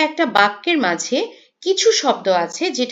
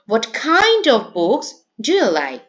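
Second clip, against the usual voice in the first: slow reading with "kind" stretched and raised; stress on KIND; much slower than usual. Shown as a demonstration. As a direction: punch "kind" in a careful teacher's reading. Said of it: "এখানে কাইন্ডটা হলো". ("kind" শব্দে জোর দিয়ে, টেনে পড়া)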